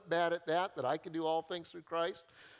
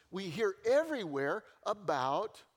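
ordinary talk, nothing marked

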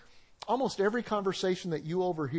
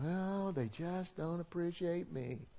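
slow, softer self-pitying mock complaint; martyr whine; 8 dB quieter, slower than usual. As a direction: sigh it like nobody appreciates you. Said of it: "Are you kinda whiny or martyr".

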